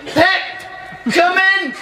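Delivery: high mock Havisham call, laughing; mock shout. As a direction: shriek 'Pip' in a hammed-up posh call. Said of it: "she shouted".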